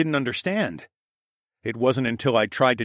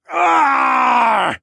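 full battle scream; 13 semitones higher, 10 dB louder than usual. Acted. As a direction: scream as you charge. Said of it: "I screamed".

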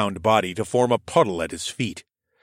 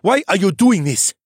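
higher, louder desperate question; frightened plea; 11 semitones higher, 7 dB louder than usual. A narrator's reading. The cheetah begs his captor, high and loud. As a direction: plead with rising panic.

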